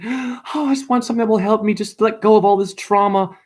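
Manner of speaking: high, pleading voice of someone desperate for relief; mock a helpless plea for rescue; put-on high-pitched whine of a trauma sufferer; needy seeker mock.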